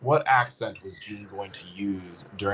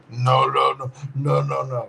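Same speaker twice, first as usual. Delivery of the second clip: a hint of the Sicario Punisher guy's firm no, a bit louder; lightly voiced stern refusal; Punisher guy impression; 3 dB louder than usual. Host imitating the Punisher guy's repeated no. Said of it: "I just hear the Punisher guy".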